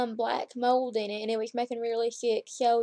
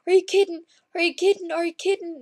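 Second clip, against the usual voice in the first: loud, higher disbelieving repeats; stunned excitement; 6 semitones higher, 6 dB louder than usual. Retold or imitated. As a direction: fire it off in happy disbelief. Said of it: "And he's like".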